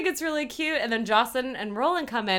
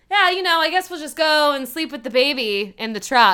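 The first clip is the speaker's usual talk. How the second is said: louder, higher put-on self-pity; guilt-tripping; 6 semitones higher, 8 dB louder than usual. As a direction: lay on a mock guilt trip. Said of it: "Trying to guilt trip them".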